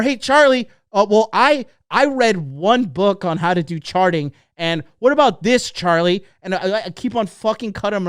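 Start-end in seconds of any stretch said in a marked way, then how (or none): 0.0-6.2 high, loud, pushy interjection; mock interrupting pupil. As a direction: pester the expert like a know-it-all beginner. Mimics a pupil interrupting Charlie.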